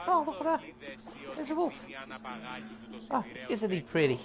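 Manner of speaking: mock guards. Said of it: "They're like".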